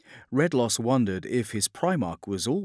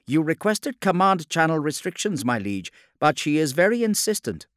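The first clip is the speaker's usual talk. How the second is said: higher, louder officer reporting to his liege; raised voice of a dutiful adept; urgent comm adept; 8 semitones higher, 6 dB louder than usual. A narrator's reading. Comm adept telling his liege of an insistent caller, urgent.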